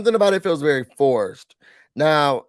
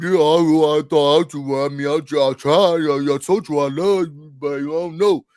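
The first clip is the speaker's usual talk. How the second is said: hesitant, halting mock husband; mock stammering excuse; 3 semitones lower than usual.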